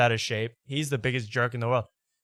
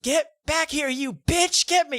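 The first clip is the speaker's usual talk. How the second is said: very high mock scream; screaming lady; 4 dB louder, slower than usual. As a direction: shriek it way up high. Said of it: "And the lady goes".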